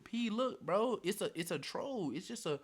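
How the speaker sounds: high-pitched, urgent retold pleading; frantic explaining. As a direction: plead to calm a panicking friend. Acts out frantic explanation to friend.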